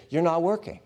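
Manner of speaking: indignant protest with "not" pushed up; accusing complaint. Retold or imitated. Voicing a complaint against God.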